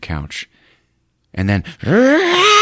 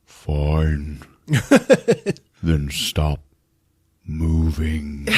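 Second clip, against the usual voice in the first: mock menacing; 4 semitones lower, much slower than usual. Possessed girlfriend threatening Jim, low mock menace. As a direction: threaten him calmly. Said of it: "she just goes".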